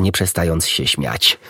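ordinary delivery, nothing marked

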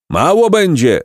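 heckling crowd cry; crowd roar. A narrator's reading. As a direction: roar your objection as a crowd. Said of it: "ryknęli w tłumie".

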